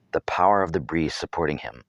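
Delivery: usual speaking voice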